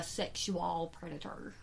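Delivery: "sexual predator" drawn out syllable by syllable, mimicking; imitated pronunciation. Quoting the woman who irritated the host, mimicked "sexual predator". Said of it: "That's the way she said it".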